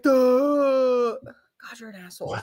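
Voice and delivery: dopey, drawn-out mock duh; put-on dim-witted groan. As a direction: imitate someone being clueless. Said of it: "and you're like".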